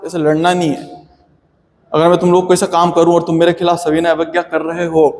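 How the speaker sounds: ordinary talk, nothing marked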